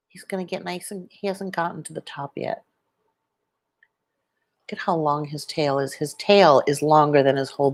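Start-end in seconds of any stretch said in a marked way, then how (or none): none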